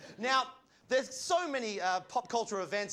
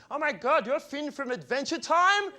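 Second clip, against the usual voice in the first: gushing fan voice; 6 semitones higher, 6 dB louder than usual. Retold or imitated. Mimicking gushing cosplay fans.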